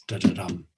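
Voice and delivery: "da-da-dum." with the beat on the final "dum"; anapaest demo. Shown as a demonstration. Teacher demonstrating the anapaest, beat on the last dum. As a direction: tap out weak weak STRONG.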